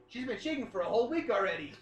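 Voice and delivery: ordinary talk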